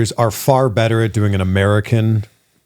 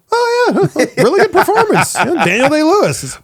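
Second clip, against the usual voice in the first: much higher, laughing approval; mock public praise. Imitating people excusing British actors, mock approving. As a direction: praise it in a high put-on voice. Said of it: "people go".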